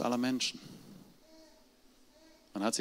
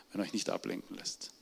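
whispered ending; 2 semitones lower, 8 dB quieter than usual. Real, not acted. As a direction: whisper it, much softer. Sermon line trails off. (geflüstert, ganz leise)

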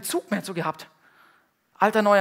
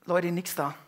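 usual speaking voice

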